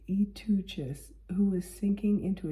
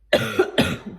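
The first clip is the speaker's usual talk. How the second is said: chesty cough; 12 dB louder than usual. Real, not acted. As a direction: cough hard, clearing the chest. Coughs again, then "Where was I?.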